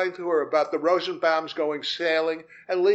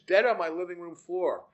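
ordinary delivery, nothing marked

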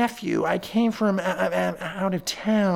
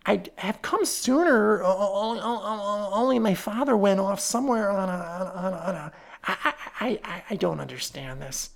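stuttering, fading nervous nephew; falter, lose the words and trail off; 3 semitones higher than usual. Acted. Nephew explaining the letter to the detective, faltering. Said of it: "he faltered".

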